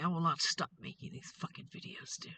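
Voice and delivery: a soft, voiceless whisper; whispered vow. Real, not acted. Telling the attacker she won't stop filming, whispered. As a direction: hiss it under your breath. Vowing defiance under her breath.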